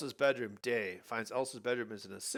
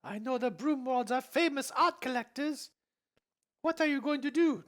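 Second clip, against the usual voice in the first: Elsa voice; 6 dB louder than usual. Acted.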